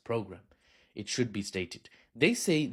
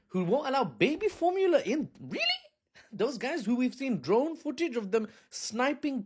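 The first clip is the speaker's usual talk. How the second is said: alarmed Western citizen; 8 semitones higher than usual. Retold or imitated. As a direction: sound shocked and disbelieving. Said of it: "They're like".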